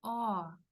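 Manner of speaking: O opened wide, like "aw". Shown as a demonstration. Teacher shows learners the difference, open O. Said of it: "Vean la diferencia".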